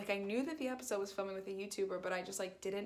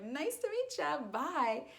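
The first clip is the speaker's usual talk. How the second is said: high awkward goodbye; 7 semitones higher, slower than usual. Retold or imitated. Awkward hasty exit.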